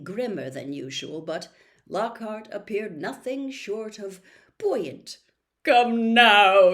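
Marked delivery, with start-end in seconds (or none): 5.6-6.7 a loud, beaming showman of a teacher; booming false cheer for the class; cheery cry. A narrator's reading. Lockhart bounding in and calling brightly to the class. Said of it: "he cried, beaming around him".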